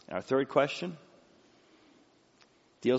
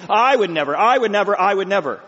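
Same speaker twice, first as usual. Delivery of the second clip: loud, high mock vow; self-righteous insistence; 6 semitones higher, 9 dB louder than usual. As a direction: insist with mimicked righteous indignation. Mimicking self-righteous vows, repeated.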